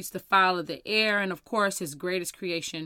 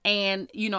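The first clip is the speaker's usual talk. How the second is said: ordinary talk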